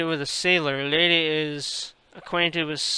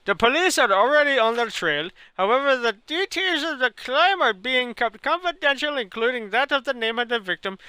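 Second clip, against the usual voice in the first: reading the news in a put-on Indian accent; a hammed-up Indian newsreader; 4 semitones higher than usual. Streamer reading a newspaper aloud in a mock Indian accent. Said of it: "talk this long with an Indian accent".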